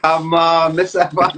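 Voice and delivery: laughing loudly, urging him on; egging him on through laughter; laughing coax. Real, not acted. Host laughing at Tom, urging him to tell a joke.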